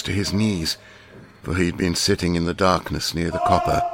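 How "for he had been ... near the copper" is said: ordinary delivery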